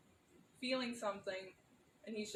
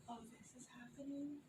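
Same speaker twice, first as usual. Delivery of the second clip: much softer, under-the-breath aside; theatrical aside; 9 dB quieter than usual. Acted. Character commenting to the audience on another character, hushed aside. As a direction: drop the volume, as if confiding to the audience. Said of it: "be at the side and be like".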